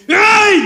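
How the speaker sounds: high-pitched drawn-out yell of "ay"; imitated shout. Preacher imitates brothers yelling on the outing, high mock shout. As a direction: imitate people yelling out in the street. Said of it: "hermanos gritaban".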